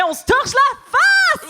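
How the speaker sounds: excited shout. Acted.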